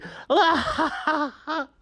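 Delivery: high, hammed-up cackle of a giggling princess; mock hysterical giggle; hysterical princess laugh. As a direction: laugh like a silly princess losing it. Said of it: "just laughing all hysterically".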